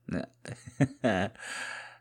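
short falling chuckle; amused laugh. Acted. Man teasing his partner with handcuffs, amused laugh.

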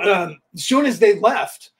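usual speaking voice

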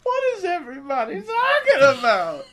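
high squealing laugh through words; laughing shout. Real, not acted. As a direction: shriek it while cracking up. Mock outrage, cracking up.

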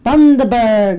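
put-on Aussie accent. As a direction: give Bundaberg an Aussie twang. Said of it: "Do it with an, an Aussie accent".

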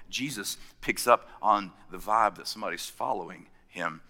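ordinary delivery

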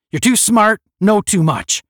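loud, high, punched accusations; barked reprimand. A narrator's reading. Captain berates a smug cadet, barking. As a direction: keep barking the criticism.

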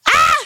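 short high scream; sudden squeal of disbelief; freaked-out scream. Real, not acted. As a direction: let out a freaked-out "ah!". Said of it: "it still freaks me out".

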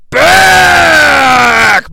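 high, loud drawn-out "Back!"; play-by-play shout. Acted. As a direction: stretch "Back!" long and loud. Shouted repeat of call.

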